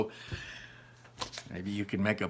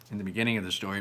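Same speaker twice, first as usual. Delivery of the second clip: ordinary delivery, nothing marked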